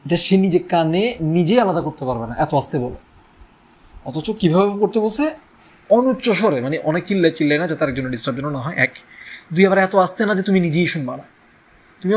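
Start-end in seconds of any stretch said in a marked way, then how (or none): none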